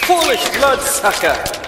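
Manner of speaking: taunting shout. Acted. Hunter taunts the hiding vampire.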